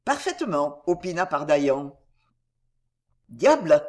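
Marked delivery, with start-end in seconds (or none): none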